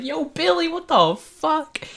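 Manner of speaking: high, slow, stretched-out disbelieving call; shouting to Billy after his wild jump, high and drawn out; amazed call. Retold or imitated. Calling after Billy jumping.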